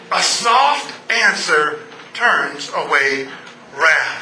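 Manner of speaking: slow gentle repeat. Acted. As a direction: savour each word. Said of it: "repeats the verse".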